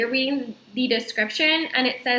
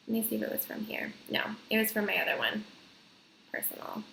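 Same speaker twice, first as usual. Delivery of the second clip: muttering; 12 dB quieter, faster than usual. Real, not acted. Muttering while searching.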